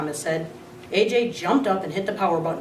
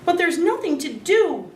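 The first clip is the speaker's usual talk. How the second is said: high, whiny complaint; whining; 10 semitones higher than usual. A narrator's reading. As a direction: whine like a bored child. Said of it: "Dealey whined".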